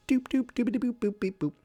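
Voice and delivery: high sing-song doop boop sounds; doop boop noises. Real, not acted. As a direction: chirp the beeps while tapping. Making app sound noises.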